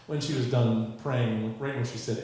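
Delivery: ordinary delivery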